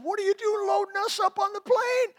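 high-pitched, incredulous scolding; mock indignant outburst. Acted scolding of the pilot.

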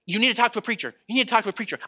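mock wifely pestering; imitated nagging wife. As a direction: nag him like a persistent wife.

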